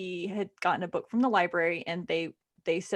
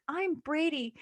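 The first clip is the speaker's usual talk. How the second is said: higher, softer imitated boy; lightly voiced boy; 6 semitones higher, 5 dB quieter, slower than usual. Other boy introduces himself, soft light child voice. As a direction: gently put on a child's voice. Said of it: "the other boy's like".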